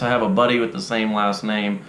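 ordinary talk

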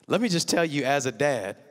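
usual speaking voice

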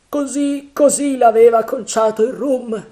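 high, loud character voice; agitated pirate. A narrator's reading. Pirate blaming rum for Flint's face, agitated. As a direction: exclaim it high and strained.

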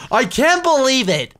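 loud, high disbelief; excited yell. Real, not acted. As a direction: shout it out in disbelief. Repeats line loud and high.

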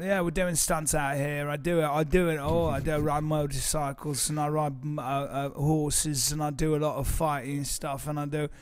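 English stuntman act; English accent. Acted. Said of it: "stuntmen from England".

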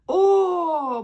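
O held long and high, on one smooth breath; drawn-out vowel demo. Shown as a demonstration. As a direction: sustain a clear, long О for learners. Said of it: "проговоріть".

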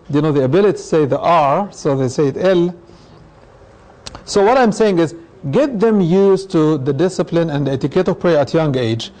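normal talking voice

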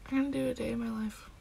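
ordinary talk

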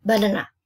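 BANANA rattled off quickly as one sound; run-together BANANA. Shown as a demonstration. Teacher showing how not to count the word, run together. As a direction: say "banana" fast with no breaks between syllables. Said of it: "You don't say banana".

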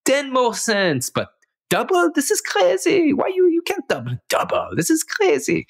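an outraged Israeli neighbor protesting the doubled price; Israeli accent. Retold or imitated. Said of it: "Good, uh, good Israeli accent".

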